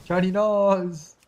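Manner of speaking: slow singsong tease; drawn-out teasing. Acted. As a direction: stretch it out slowly, teasing. Teasing friend over shiny name.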